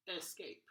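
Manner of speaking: escape said as ESS-cape; spelled-out first vowel. Shown as a demonstration. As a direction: give escape a clear, full first E. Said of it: "it looks like it should say".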